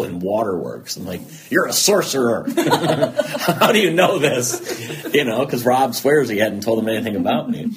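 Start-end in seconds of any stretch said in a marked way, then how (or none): 1.5-7.7 louder, laughing through the words; amazed laughter while speaking. Real, not acted. Laughing while telling the therapy story.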